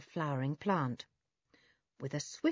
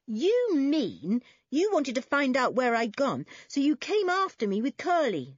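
higher, louder scolding; raised-voice telling-off; accusing older brother; 7 semitones higher, 6 dB louder than usual. Acted. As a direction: confront the little one with what he did. Toby scolds his little brother.

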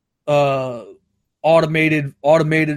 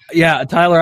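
ordinary delivery

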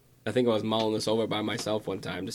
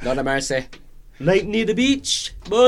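put-on Scottish accent; 9 semitones higher, 8 dB louder than usual. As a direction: ham up a thick Scottish accent. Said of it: "awfully Scottish".

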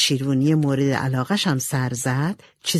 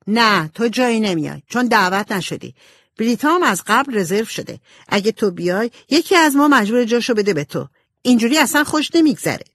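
firm refusal; 6 semitones higher, 4 dB louder than usual. A narrator's reading. Jo refuses Amy firmly.

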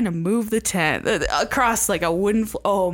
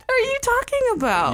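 high fast disbelief; incredulous; faster than usual. Retold or imitated. Counselor answering gossiping staff, high incredulous. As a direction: ask in disbelief. Bewildered response.

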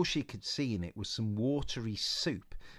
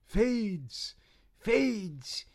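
fading echo; 12 semitones higher, 5 dB louder than usual. Acted. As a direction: let the word fade away. Vision fading effect.

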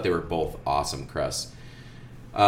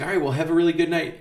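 high cheerful voice; 10 semitones higher, 5 dB louder, faster than usual. Retold or imitated. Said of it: "I was like".

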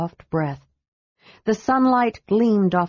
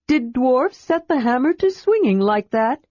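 curious kender; 6 semitones higher than usual. Acted. Tas curious.

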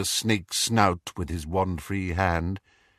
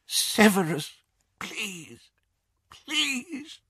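high, faint, whispered begging; desperate pleading; 12 semitones higher, 4 dB quieter, much slower than usual. Acted. Captive woman begging Snape, terrified whisper. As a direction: plead breathlessly for mercy.

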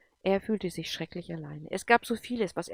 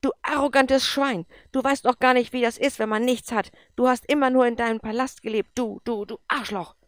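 yelled high and loud, stammering at the end; bellowing; 6 semitones higher, 10 dB louder than usual. A narrator's reading. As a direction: roar at him with fury. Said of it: "brüllte er ihn an". (wütend brüllend)

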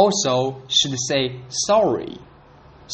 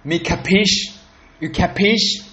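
higher, tough mobster question; 5 semitones higher than usual. Retold or imitated. Imitating a movie gangster pressing someone. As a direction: push it like a New York gangster. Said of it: "sounds pretty tough".

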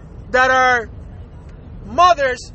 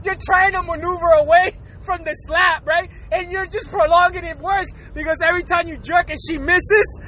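ordinary talk, nothing marked

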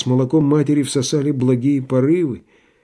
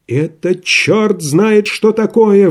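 вскрик, резко выше и громче; взорваться от возмущения; возмущённый вскрик; 8 semitones higher, slower than usual. Acted. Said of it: "вскрикнул вдруг Иван Дмитрич". (Иван Дмитрич вскрикивает на санитара, возмущённо)

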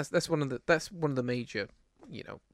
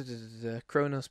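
ordinary talk, nothing marked

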